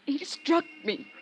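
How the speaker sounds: slow tearful sob; crying. Acted. Woman tells detective she was struck, crying. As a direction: sob it out, shaken after being hit.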